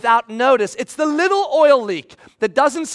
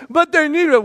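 higher, louder plea; pleading protest; 6 semitones higher, 6 dB louder than usual. Retold or imitated. Pleading with boss.

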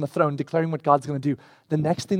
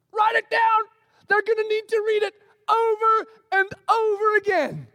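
insistent shout. Acted. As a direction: shout it so he won't forget. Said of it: "He doubles down".

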